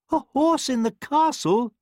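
very high, shrieked disbelief; high-pitched royal scream. A narrator's reading. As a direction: shriek it like an outraged queen. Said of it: "the queen screamed".

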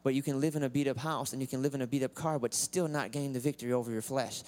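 normal talking voice

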